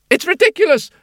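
outraged governess. Acted.

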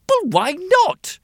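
high, loud whiny king; spoiled royal demand; petulant king. Acted.

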